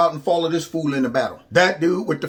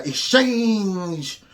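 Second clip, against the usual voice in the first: "exchange" drawn out very slowly, mocking; over-the-top emphasis. Host mocks the scripted speaker, over-the-top emphasis on "exchange". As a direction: stretch "exchange" with hammed-up weight. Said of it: "going way over the top with the emphasis on certain words".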